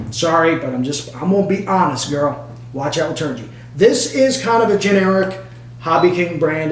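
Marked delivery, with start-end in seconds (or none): none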